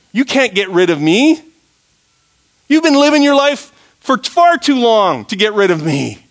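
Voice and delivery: loud, high preacher playing Fear. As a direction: taunt them as Fear, pitch climbing high. Preacher voices taunting Fear.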